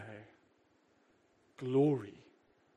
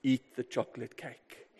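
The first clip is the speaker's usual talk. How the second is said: tempter's whisper; 5 semitones lower, 6 dB quieter than usual. Retold or imitated. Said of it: "He whispers in your ear".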